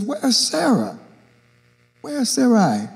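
ordinary talk, nothing marked

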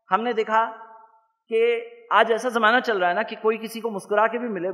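ordinary talk, nothing marked

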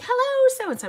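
high, loud, singsong hello; exuberant mock greeting. Streamer re-enacting greeting her friend, exuberant call.

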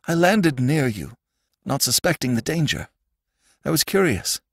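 high-pitched wistful confession; tearful Sandman. Acted. Sandman telling how he landed here, plaintive. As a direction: explain plaintively, phrase by phrase.

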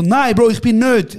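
loud, slow shouted denial; retold yell. Yelling at a fan in a loud club. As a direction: yell it over the club music. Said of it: "ich schrei".

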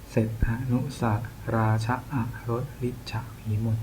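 near-whispered verse, barely voiced; whispered reading. Real, not acted. Tutor checking the third verse, whispered reading.

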